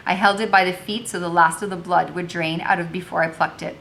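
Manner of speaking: ordinary delivery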